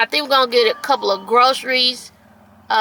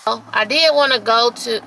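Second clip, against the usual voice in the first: normal talking voice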